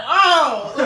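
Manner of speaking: loud laughing Oh of disbelief; shocked laugh. Pretend. Woman imagining catching her date flirting, loud shocked laughing Oh.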